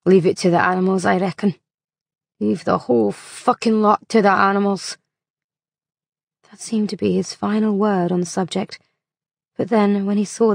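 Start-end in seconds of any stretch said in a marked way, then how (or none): 0.1-5.0 disdainful. A narrator's reading. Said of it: "He grunted disdainfully".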